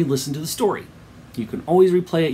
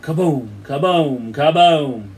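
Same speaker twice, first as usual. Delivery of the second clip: three imitated booms, growing louder; building bomb blasts; drawn-out kabooms swelling to loud; explosion booms; 7 dB louder, much slower than usual. A narrator's reading. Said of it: "Then the explosions began".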